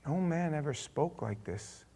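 very soft, slow and stunned; awed hush. Acted. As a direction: answer almost under your breath, awestruck. Stunned officers answer, hushed.

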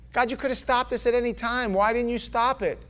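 high-pitched protest to God; pleading with God. Acted. Acted complaint to God.

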